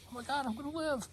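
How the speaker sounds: high, elated cry of relief; overjoyed relief. Retold or imitated. Hearing it was a lab error, overjoyed high relief. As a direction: burst out with joy at being saved.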